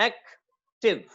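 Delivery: "Active" broken slowly into two syllables, एक, टिव; split into syllables. Shown as a demonstration. Teacher counting the syllables of "active" for students, slowly split. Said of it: "दो syllables हैं".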